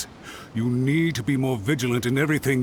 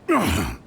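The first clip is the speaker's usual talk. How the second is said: awkward throat clear; 8 semitones lower than usual. Acted. Flustered guard clears throat.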